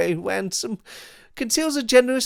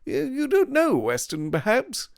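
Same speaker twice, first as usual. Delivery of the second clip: mocking Devine; 12 semitones higher than usual. A narrator's reading. Devine mocks school sentiment.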